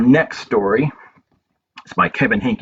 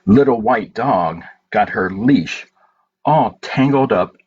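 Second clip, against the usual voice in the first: gentle, unhurried picture-book reading with long pauses; reading to children; slower than usual. Acted. Reading A Good Day to children at story time, gentle and slow. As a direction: read it slowly to little ones, letting each page land.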